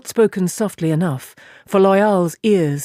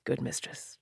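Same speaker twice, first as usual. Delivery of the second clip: fading whisper; 4 semitones lower, 17 dB quieter than usual. A narrator's reading. Trailing off tiredly.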